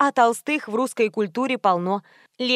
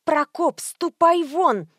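high, drawn-out command; stern shooing; 6 semitones higher, slower than usual. A narrator's reading. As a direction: send the animal off firmly. Heroine orders donkey away.

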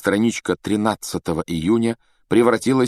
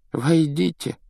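put-on higher, feeble old man's voice; weak voice; 7 semitones higher, slower than usual. A narrator's reading. Old headmaster answers a knock at the door, weakly. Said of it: "произнёс старик слабым голосом".

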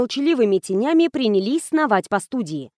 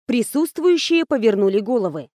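ordinary delivery, nothing marked

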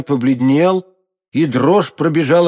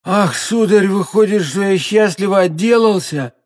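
high, plaintive voice after a fright; fearful relief, pitched up; frightened servant; 7 semitones higher than usual. A narrator's reading. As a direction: exclaim to your master, still scared stiff. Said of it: "еле живой от страха".

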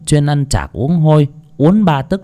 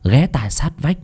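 plain reading voice

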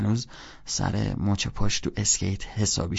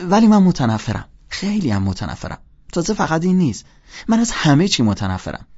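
loud, heated rant, rising in anger; shouting rant; 4 semitones higher, 11 dB louder than usual. Acted. Holden ranting at Sally in the bar, shouting angrily. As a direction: let it all pour out at full volume. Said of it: "لطفاً داد نزن".

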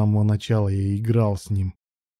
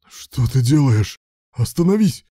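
pained cry; 3 semitones higher, 4 dB louder than usual. A narrator's reading. Man in sudden pain begs his partner to stop, pained cry. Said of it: "он не смог сдержать крик".

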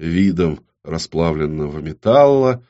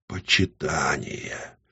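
slow, soft, lower echo; subdued repetition; 3 semitones lower, 7 dB quieter, slower than usual. A narrator's reading. Woman echoing the demon's oath, subdued. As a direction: repeat it meekly. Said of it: "Она должна была повторять".

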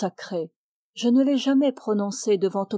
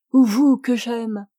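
ordinary delivery

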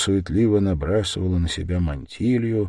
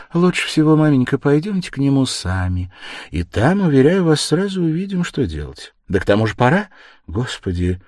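put-on raised young woman's voice, pressing; Dunya urging; 9 semitones higher, 6 dB louder than usual. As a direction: coax her mother out the door.

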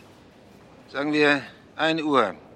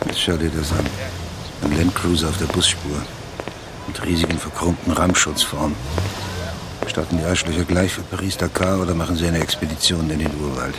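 lower, louder griping at a road hog; annoyed rant; 6 semitones lower, 5 dB louder than usual. Acted. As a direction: vent at the other driver. Driver ranting at another car.

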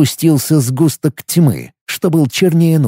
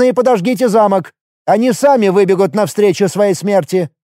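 commanding general; 8 semitones higher than usual. A narrator's reading. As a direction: give orders like a bird general. General explains his plan.

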